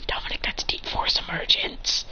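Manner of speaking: hushed whispered aside; soft, voiceless whisper. Acted. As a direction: whisper the move's name. Aside naming Dominic's move.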